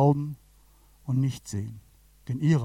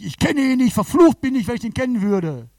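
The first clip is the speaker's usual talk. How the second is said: loud, high, vehement oath; cursing denial; 7 semitones higher, 7 dB louder than usual. Acted. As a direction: swear you don't know him, almost shouting. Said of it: "da verflucht Petrus sich".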